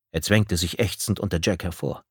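ordinary delivery, nothing marked

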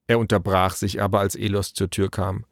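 ordinary delivery

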